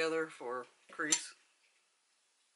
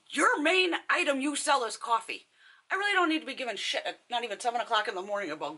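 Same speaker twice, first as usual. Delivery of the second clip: high, loud indignant rant; mock outraged scolding, rushing on; acted telling-off; 7 semitones higher, 4 dB louder than usual. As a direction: lay into the clerk, starting high and loud. Imagined telling-off of the clerk.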